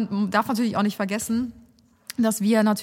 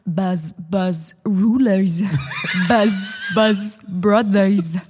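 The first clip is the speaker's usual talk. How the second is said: slow, exaggerated pronunciation drill; mock language-tape speaker; textbook CD voice; 3 semitones lower, 3 dB louder, much slower than usual. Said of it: "dann kam da immer so".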